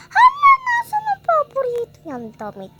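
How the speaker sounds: high crying yell; panicked shout. Acted. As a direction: wail in panic. Panicked search.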